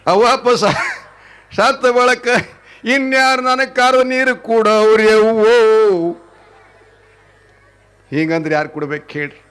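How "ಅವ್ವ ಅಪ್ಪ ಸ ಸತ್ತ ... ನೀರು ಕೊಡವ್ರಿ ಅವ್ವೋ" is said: louder, drawn-out imitation of a woman's wail; slow, loud mock keening; mock wailing lament. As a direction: ham up a mourning cry for the dead parents. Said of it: "ಕರಿಲಾರ್ದಂಗೆ ಅಳ್ತೀರಿ".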